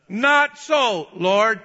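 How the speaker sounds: loud, high defiant refusal. Acted. Peter telling God no, loud defiant refusal. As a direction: tell God no at full voice. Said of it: "He's telling God no".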